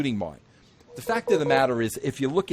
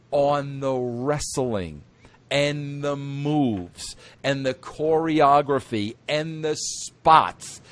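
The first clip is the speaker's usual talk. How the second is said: slow emphatic; slower than usual. Real, not acted. Slowing down to hammer a point.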